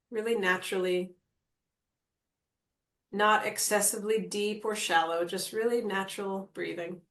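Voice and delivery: calm meditation guide with long pauses; guided meditation. Acted. Guide settles the group into natural breathing, calm meditation voice. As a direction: ease them into stillness, leaving space between phrases.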